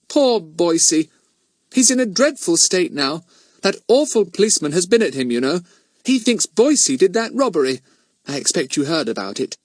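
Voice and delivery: high, pitying chatty voice; Missus Thomas, concerned woman. A narrator's reading. Woman tells visiting girls of her friend's trouble, concerned. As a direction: confide the news with sympathy.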